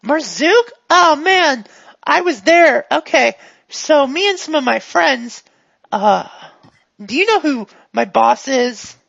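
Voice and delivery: high, eager storyteller; chatty, addled character. Acted.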